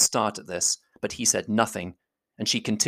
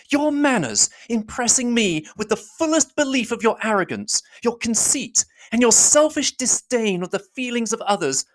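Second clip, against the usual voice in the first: put-on higher young woman's voice, accusing; a higher heroine's voice pressing her charge; Elizabeth, indignant; 10 semitones higher than usual. Elizabeth confronting Darcy, higher and accusing.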